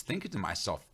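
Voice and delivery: usual speaking voice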